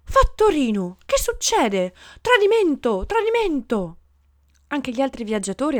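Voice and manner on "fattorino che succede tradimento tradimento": high, loud cry of outrage; alarmed shouting. A narrator's reading. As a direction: call out the betrayal at the top of your voice. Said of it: "si mise a gridare".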